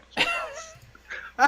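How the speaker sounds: shrieking laugh; high squeal of laughter. Real, not acted. Laughs mid-sentence about Tank.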